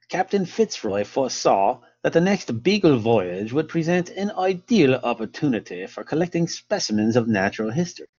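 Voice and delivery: hammed-up amateur English accent, lilting. Lecturer reading Fitzroy's account to listeners, in an English accent. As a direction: read it out in your best English accent. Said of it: "best English accent".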